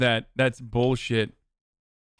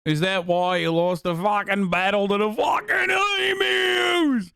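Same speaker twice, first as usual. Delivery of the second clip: mock-incredulous Australian, building to a high shout; hammed-up Aussie outrage; Australian accent; 3 semitones higher than usual. Streamer taunting Australians over the lost emu battle, mock Australian accent. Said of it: "my Australian accent".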